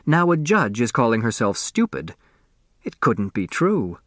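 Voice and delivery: plain reading voice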